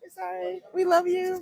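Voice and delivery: high, cutesy message to an absent listener; playful joint apology. Pretend. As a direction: send love in a playful high voice. Host joins the playful apology.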